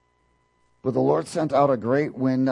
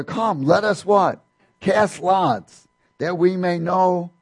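sailors urging; 7 semitones higher, 5 dB louder, slower than usual. Acted. Sailors urging each other.

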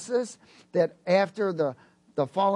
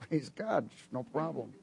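normal talking voice

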